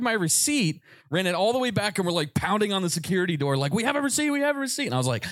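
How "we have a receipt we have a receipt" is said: high urgent repeated plea; frantic friends. Retold or imitated. Friends banging on the security door, high frantic plea. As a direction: pound on the door and shout it up high.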